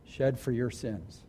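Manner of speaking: low, soft, with long pauses; hushed solemnity. Real, not acted. Preacher dwelling on Christ's sacrifice before the congregation, hushed and solemn. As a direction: let each phrase sink in, quiet and weighty.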